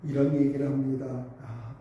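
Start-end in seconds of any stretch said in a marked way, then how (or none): 1.4-1.8 hushed, low sigh of defeat; despairing lament. Retold or imitated. Father before his burned-down house, quietly lamenting. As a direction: sigh it out softly, as if all is lost. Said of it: "탄식하면서".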